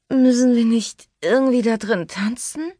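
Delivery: high, slurred tipsy woman; slurred drunk. Acted. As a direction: slur it like she's had far too much. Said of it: "kam es verwaschen aus ihrem Mund". (verwaschen, hoch und betrunken)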